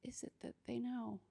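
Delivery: hushed fearful inner voice; whispered thought. Retold or imitated. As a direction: whisper it to yourself in dread.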